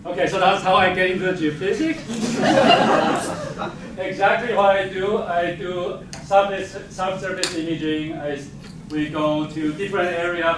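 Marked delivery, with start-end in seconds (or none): none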